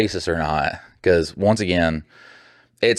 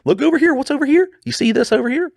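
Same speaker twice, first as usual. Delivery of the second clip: much higher, sing-song teasing look over here; mock distracted voice. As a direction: play a flighty kid pointing at shiny things. Mocking easily distracted people.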